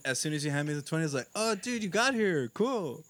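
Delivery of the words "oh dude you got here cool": higher, slow, overdone welcome; mock friendly greeting. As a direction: ham up greeting a long-lost friend. Pretending to greet a fake party member.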